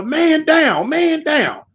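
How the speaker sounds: mild mock yell; retold holler. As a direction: sound the alarm for the yard. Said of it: "So somebody holler".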